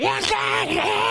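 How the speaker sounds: high, slow drawn-out yell; mock yell. Comedian imitating his mother yelling at deaf dad, high slow shout. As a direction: stretch out the shout like an angry old woman. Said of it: "I hear, like".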